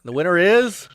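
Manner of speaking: excited winner reveal, rising high; hyped giveaway announcement. Real, not acted. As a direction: call out the winner with a big rise. Giveaway wheel spin winner reveal.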